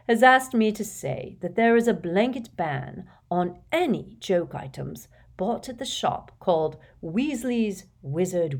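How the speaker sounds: stately public welcome with swelling opening phrases; headmaster's address. Acted. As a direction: address a whole hall, measured and ceremonious. Voices headmaster's announcements.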